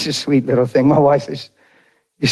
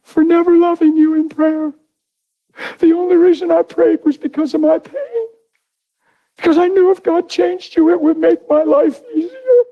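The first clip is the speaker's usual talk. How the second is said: very high, sustained tearful confession, imitated; bawling wife; 14 semitones higher than usual. Wife confessing why she prayed, high imitated bawling. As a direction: mimic a weeping wife pouring it out. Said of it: "And she's bawling".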